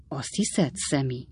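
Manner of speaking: quiet, serious question to his son. Acted. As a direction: ask it gravely and softly. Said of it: "komoly hangon".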